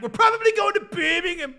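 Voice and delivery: high, loud mock British accent; imitated British accent. Teasing on the trip to Birmingham, imitated British accent. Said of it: "and I kept being like".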